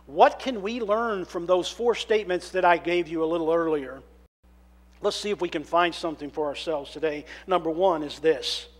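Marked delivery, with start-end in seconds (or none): none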